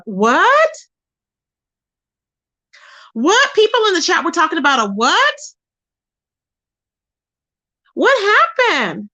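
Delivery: real alarm, pitch shooting up; shocked. Real, not acted. Hearing chat mention a gun, shocked and high.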